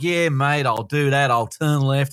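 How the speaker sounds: put-on light Australian accent, laid-back; relaxed Aussie sat-nav voice, lightly done. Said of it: "You did a great accent just there".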